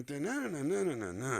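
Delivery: high, loud "da-na-na" sentence tune; hummed speech melody. Shown as a demonstration. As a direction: trace a line's pitch contour in nonsense syllables. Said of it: "We're hearing the melody, the pitch".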